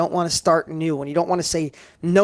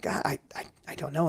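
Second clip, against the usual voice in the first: breathy, hushed, voice almost gone; recalling a tearful moment, whispered; choked-up whisper; 8 dB quieter than usual. Retold or imitated. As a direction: let it catch in your throat as you admit you don't know.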